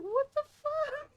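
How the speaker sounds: put-on cornered boyfriend squeaks at the roasting, high and slow; mock boyfriend.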